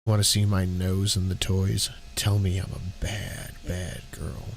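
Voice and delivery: low, slow seductive read; sexy voice. Acted. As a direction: purr it like a seductress. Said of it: "I'll read this in a sexy voice".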